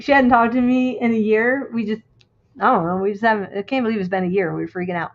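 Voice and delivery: ordinary delivery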